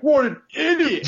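high, loud, drawn-out screech; mock screaming. Angry teammate screaming at the player who missed, exaggerated high scream. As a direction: imitate a teammate screaming in disgust. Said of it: "like, screaming at me".

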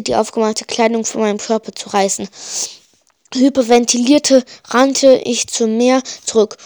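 plain reading voice

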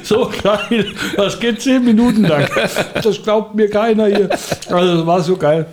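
laughing through the words; words bubbling with laughter. Real, not acted. Laughing at own story.

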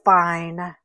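grudging sigh. Acted. Coyote gives in reluctantly.

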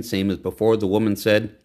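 ordinary delivery, nothing marked

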